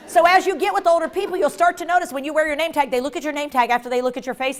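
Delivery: usual speaking voice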